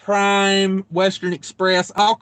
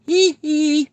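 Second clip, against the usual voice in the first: high squealed hee hee, [name] style; 10 semitones higher than usual. Retold or imitated. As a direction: squeal it like the King of Pop. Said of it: "That's [name] for you right there".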